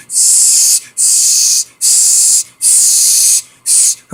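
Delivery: long, drawn-out angry turtle hiss. Retold or imitated. Storyteller imitates the big turtle they met, hissing. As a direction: imitate a snapping turtle with sustained hisses. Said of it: "he's hissing at us".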